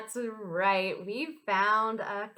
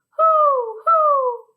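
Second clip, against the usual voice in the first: loud high owl hoots; 12 dB louder than usual. Pretend. Teacher answering the owl song for children, high hooting. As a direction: hoot out long like an owl. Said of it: "say hoot".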